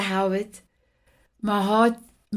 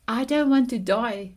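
ordinary delivery

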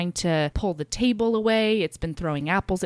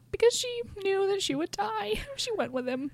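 real tearful voice, high and soft; crying; 13 semitones higher, 7 dB quieter than usual. Real, not acted. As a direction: squeeze the words out high through tears. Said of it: "Melody just started crying".

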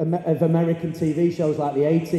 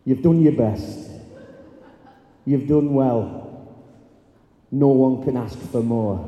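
lower, slow and gentle, soothing; gentle reassurance; 6 semitones lower, slower than usual. Retold or imitated. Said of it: "this big, sometimes angry young man said to a chair".

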